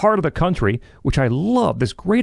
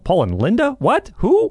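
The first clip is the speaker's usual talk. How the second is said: mock surprise; 8 semitones higher than usual. Mock puzzlement at joint album credit.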